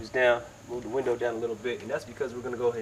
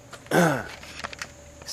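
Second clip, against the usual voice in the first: throat clearing; 5 semitones higher than usual. Real, not acted. Tutor getting over a cold.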